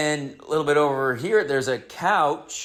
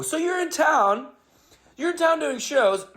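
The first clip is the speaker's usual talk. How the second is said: much higher, bright anchor sing-song; perky anchor; 13 semitones higher than usual. Retold or imitated. News anchor steering past the bug talk, perky. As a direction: chirp it like a chipper TV host.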